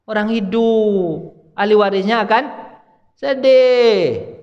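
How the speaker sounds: loud, high preacher with drawn-out key words; forceful preaching. Acted. Preacher warning people not to hurt the bereaved, forceful preaching. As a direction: stretch the Arabic and punch it to the crowd.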